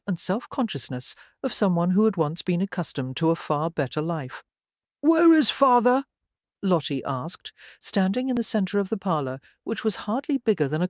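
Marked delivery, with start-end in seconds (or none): 5.0-6.0 put-on high, louder young woman's voice; a lightly voiced anxious daughter. A narrator's reading. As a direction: ask for father with a sudden urgency. Daughter asks mother urgently.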